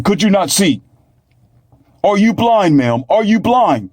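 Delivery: police officer shouting at a reckless driver, high and furious; angry officer yelling. Retold or imitated. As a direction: blast her like an outraged policeman. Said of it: "And I can hear the officer from the window".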